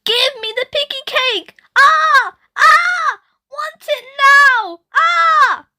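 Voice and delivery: high, loud shrieking demands; shouting child wailing for cake; toddler tantrum yelling. Acted. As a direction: scream it like a furious toddler. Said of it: "Ruby went on yelling".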